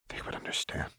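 barely voiced whisper. Seraphine whispers her grim resolve to Renata. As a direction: breathe it out softly. Quiet resolve about dying.

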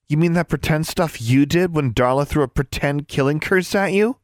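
high, scornful question; mock her enthusiasm; scoffing. A narrator's reading. Anna scoffs.

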